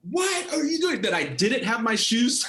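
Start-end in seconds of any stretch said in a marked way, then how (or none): 0.1-1.0 imitating the coach, pitched up high; a retold coach's high outburst; mock coach yell. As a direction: play the startled coach barking at his player. Coach finds player unprepared.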